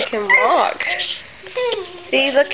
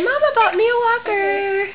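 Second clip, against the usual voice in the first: put-on high sing-song prompt for the baby to copy; modelling words for baby; 10 semitones higher than usual. Shown as a demonstration. Mother models a sentence for her baby, high sing-song. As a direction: say it high and bright like a model line.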